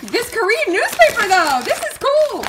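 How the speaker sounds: high, loud fangirl outburst; excited yelling. Real, not acted. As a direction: yell it high in sheer excitement. Overexcited about a Korean newspaper.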